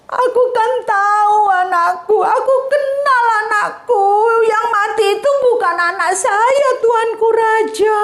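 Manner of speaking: a high, tearful, half-sung plea; crying mother. Acted. A grieving mother insists to the king it is her child, tearfully high. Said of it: "Lalu sambil menangis".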